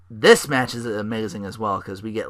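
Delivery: ordinary talk, nothing marked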